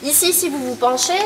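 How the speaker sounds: ordinary talk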